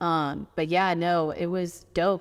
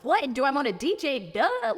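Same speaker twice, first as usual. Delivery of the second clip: excited; 7 semitones higher than usual. Retold or imitated. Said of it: "I was like".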